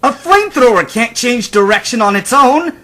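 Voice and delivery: loud, high indignant protest; frustrated outburst. Acted. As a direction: protest in angry disbelief. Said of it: "chuckled at the challenger's frustration".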